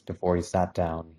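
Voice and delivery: ordinary delivery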